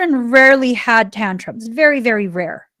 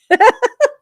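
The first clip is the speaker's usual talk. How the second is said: sudden high loud laugh; high burst of laughter; 14 semitones higher, 7 dB louder than usual. Real, not acted. Mother amused at her son's reply, laughing loudly. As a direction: let a big amused laugh pop out.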